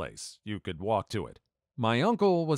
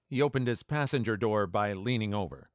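plain reading voice

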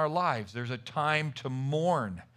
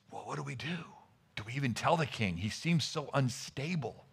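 soft, lowered voice, conferring in secret; hushed worried whispering; 2 semitones lower, 5 dB quieter than usual. Acted. Servants whispering outside the king's bedroom door, hushed. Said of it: "whispering about this".

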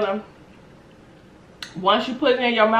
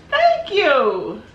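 high delighted thanks; 11 semitones higher, 5 dB louder than usual. Real, not acted. Someone hands her napkins.

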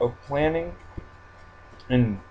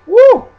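high loud whoop of joy; excited whoop; 17 dB louder than usual. Real, not acted. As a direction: let out a loud happy whoop. Excited cheer while playing.

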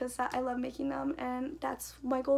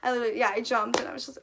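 ordinary talk

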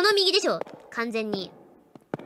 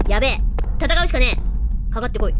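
louder, frantic yelling searching for the toilet; panicked shouting; 3 semitones lower, 6 dB louder, faster than usual. Real, not acted. Streamer lost and chased, panicked yelling. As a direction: scramble and yell in real fear.

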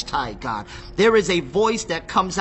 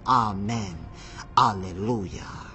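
low reverent; 8 semitones lower, 6 dB quieter than usual. Acted. Reading heavenly elders' worship response.

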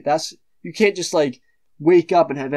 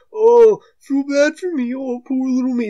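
high, whiny mock victim; mock him in a high whine; sarcastic whimpering imitation; 9 semitones higher, 4 dB louder than usual. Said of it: "He's like".

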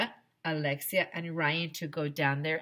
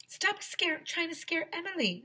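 put-on high-pitched bossy girl voice; high scolding schoolgirl; 13 semitones higher than usual. A narrator's reading. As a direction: tell him off in a high child's voice. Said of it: "Andrea told me".

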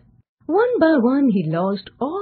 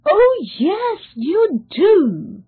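high, emphatic assurance; emphatic, mildly dramatic reassurance; assuring; 10 semitones higher, 6 dB louder, slower than usual. A narrator's reading. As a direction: insist firmly, louder and higher. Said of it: "the voice assured him".

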